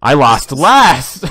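slow, loud, laughing gloat; drawn-out laughing shriek; shrieking jackpot winner. Real, not acted. Gloating over the game's outcome.

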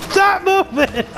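high, loud yell; frantic shout. Real, not acted. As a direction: scream at it to stay down. Shooting at zombie.

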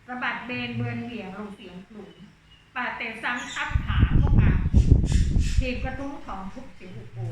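measured, lilting Thai verse reading; poem recital. Acted. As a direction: read the verse aloud for the class. Teacher reads verse passage.

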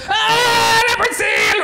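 high, loud mock panic; hammed-up startled yell; mock scream. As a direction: yelp it as if fleeing. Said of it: "So if you go".

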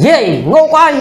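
mock yell, pitched well up; retold call-out. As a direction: imitate calling out to rouse a dozing granny. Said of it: "ដល់ស្រែកថា".

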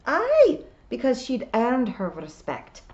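high loud outburst on Aye; ringing indignant Scots. A narrator's reading. William defending the old Kelda, ringing indignation. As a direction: fire back loudly as a Scot. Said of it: "voice seemed to echo around the stones".

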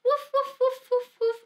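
quick high-pitched playful woofs; dog barking. Acted. Teacher showing small children a puppy, playful high barking. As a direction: bark like a friendly little puppy. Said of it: "el perro hace uf".

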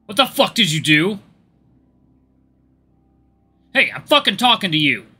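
loud, high angry yell; furious yelling. Acted. Abusive partner screaming at Cody, furious. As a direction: yell at him, full of contempt. Acted abusive quarrel flashback.

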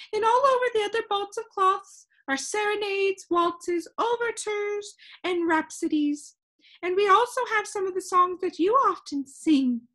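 high, loud, enthusiastic character voice; excited shopkeeper calling out proudly; crying out. A narrator's reading. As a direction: show off the wares with high-pitched gusto. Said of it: "she cried".

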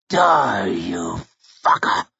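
drawn-out curse with the final blow; murderous fury. A narrator's reading. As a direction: spit the curse out with savage effort. Logan's last furious blow.

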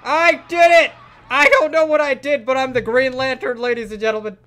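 high, loud victory shout, fading down; triumphant shouting. Real, not acted. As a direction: cheer like you just won. Escapes the monster.

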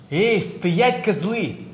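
loud retold yell; shouted quote. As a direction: bark it like a street tough. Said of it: "как они крикнули нам в спину". (громкий окрик)

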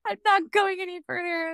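high, melodramatic whine of giving up; dramatic quitter. Retold or imitated. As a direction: play the exhausted mom who is done pushing. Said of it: "And I was so dramatic".

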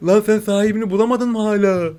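high, teasing voice to a kitten; talking to the kitten. Real, not acted. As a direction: coo at the lost kitten.

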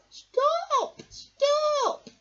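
very high, loud shout; squeaky urgent shout; shouting stop. Acted. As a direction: yell after the lorry. Boy chases bin lorry.

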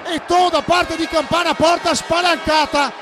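excited shouted goal call; breathless italian goal commentary. Real, not acted.

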